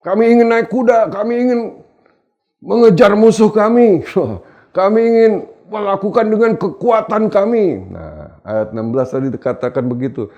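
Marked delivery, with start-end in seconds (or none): 0.0-7.9 high, loud, insistent protest; defiant people's voice. Acted. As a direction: play the stubborn people refusing. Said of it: "kamu berkata".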